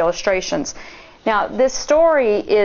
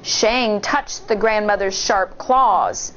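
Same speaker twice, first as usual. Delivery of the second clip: ordinary delivery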